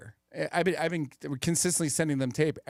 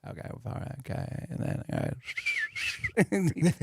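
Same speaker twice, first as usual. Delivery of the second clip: Matthew McConaughey impression; much slower than usual. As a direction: murmur the phrases like an engine starting up. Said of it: "He's like".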